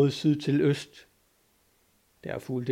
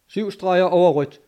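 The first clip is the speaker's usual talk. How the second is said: loud, higher shouted answer; raised voice over the machinery; called-out report; 5 semitones higher, 6 dB louder than usual. A narrator's reading. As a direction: call it up from the engine room. Engineer calls back up from engine room.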